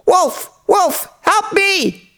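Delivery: put-on very high-pitched little boy crying wolf; acted high boy's shout, no real volume; mock shout for help. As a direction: squeal it out like a boy calling for help. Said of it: "shouted as loud as he could".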